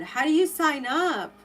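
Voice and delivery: usual speaking voice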